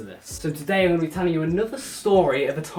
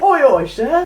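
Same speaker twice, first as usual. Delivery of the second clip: 1 loud greeting; 9 semitones higher, 9 dB louder, slower than usual. Retold or imitated. Lads greeting teacher loudly.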